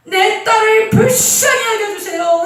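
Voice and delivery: high, loud pleading cry; desperate shouting. Acted. Canaanite mother begging Jesus to save her daughter, loud desperate cry. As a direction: cry out begging for mercy, again and again. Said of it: "이렇게 하고 소리 소리 지른 거예요".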